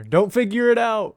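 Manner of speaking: loud, high panicked yell; shouting. Acted. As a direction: yell it to stop him. Shouting at the other character.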